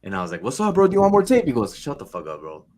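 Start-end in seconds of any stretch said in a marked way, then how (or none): 0.4-1.5 high, loud, chummy greeting; upbeat mock cold call; cheery salesman. Acting cheery follow-up sales call. 1.8-2.7 low, soft brush-off; imitated unimpressed customer; deadpan dismissal.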